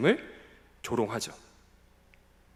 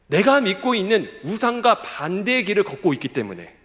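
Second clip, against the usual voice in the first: loud, raised preaching voice; impassioned preaching; 5 semitones higher, 6 dB louder than usual. Real, not acted. Preacher denouncing scorn for God's prophets, impassioned preaching. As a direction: drive each phrase home forcefully. Preacher rises to full force.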